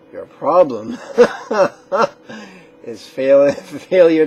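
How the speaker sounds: loud laughter breaking into the words; teasing blame, broken by real laughs; laughing. Real, not acted. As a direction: crack up mid-sentence, then push on loudly. Laughs while blaming the viewer.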